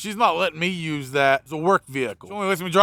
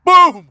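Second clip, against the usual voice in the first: much higher and louder, a sudden mock impact yell; loud boom shout; 11 semitones higher, 13 dB louder than usual. Mechanic acting out hitting the customer with cash, loud boom shout. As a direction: bark the impact like a cartoon hit. Throwing money at his head.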